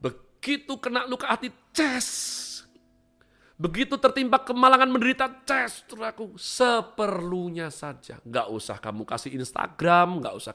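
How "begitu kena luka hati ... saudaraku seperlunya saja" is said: high and loud, rising fervour; full-force preaching. Acted. Preacher urging the faithful to keep calm, fervent and loud. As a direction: preach it hard over the crowd.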